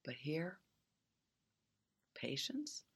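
hushed, barely voiced; whispered. Real, not acted. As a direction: lean in and breathe the words. Intimate reflective aside.

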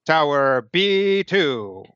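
slow, drawn-out robot announcer; robotic voice. Acted. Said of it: "the deep robotic voice and says".

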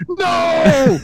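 high, loud mock scream of no. Retelling the screamed refusal to the agent, high loud mock scream. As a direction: scream the refusal in a hammed-up rerun.

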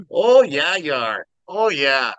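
mock Minnesotan, punched then dropping; imitated Minnesota agreement, swooping; Dave. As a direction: repeat it with a Minnesotan lilt. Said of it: "And Dave was like".

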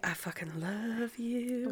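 soft, slow love message; hushed, drawn-out adoration; tender declaration. Acted. Fan addressing a favorite actor, soft and adoring.

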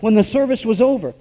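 ordinary talk, nothing marked

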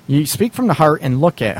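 ordinary delivery

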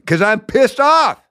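high, drawn-out angry outburst; irate high-pitched answer to a critic; pissed-off yell. Acted. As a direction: snap back high and stretched out, fed up. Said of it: "What are you yelling".

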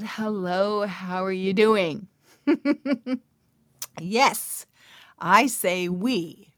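ordinary talk

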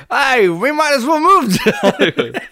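high, laughing mock British; British accent attempt. Co-host joining partner's bit again, high mock British accent. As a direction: join in with a silly British accent.

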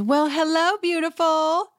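high, sing-song Kyle greeting; mock Kyle. Kyle greeting the horse, high and coy. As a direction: coo it high and flirty. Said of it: "she says".

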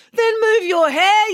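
high, loud drawn-out yell; slow, loud, high retort. A narrator's reading. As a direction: yell it back furiously. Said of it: "yelled April".

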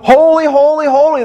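hint of an angel choir crying holy; angels' cry. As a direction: lift it like a heavenly call. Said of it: "they cry".

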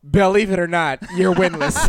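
teasing laugh. Real, not acted. Teasing co-host about losses.

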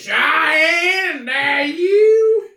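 high, loud shouted call; man doing a woman's voice. Acted. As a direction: greet like a loud mom. Imitating mom calling out.

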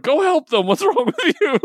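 very high-pitched exasperated squeal; exasperated scolding. Retold or imitated. Reader reacting to Guy Gardner trolling instead of helping, high-pitched exasperation. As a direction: squeak it out in mock disbelief.

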